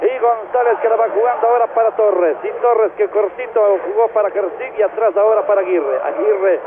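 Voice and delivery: breakneck goal commentary. Real, not acted. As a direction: call it fast, then explode on gol. Radio goal commentary clip.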